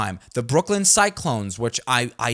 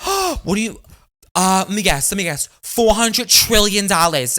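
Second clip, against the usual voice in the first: mock excited guessing; 5 semitones higher, 5 dB louder than usual. Friend mocks his money news.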